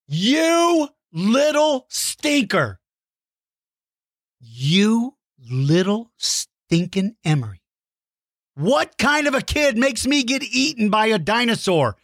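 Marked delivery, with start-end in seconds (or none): 0.1-7.6 slow, drawn-out playful grumble; mock scolding. Host playfully scolding the kid by name. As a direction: drag each word out, fake-grumpy.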